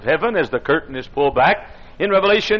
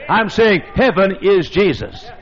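ordinary talk, nothing marked

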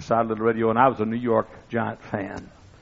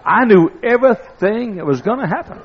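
excited; 10 semitones higher, 7 dB louder than usual. Real, not acted. Telling the tale with rising thrill.

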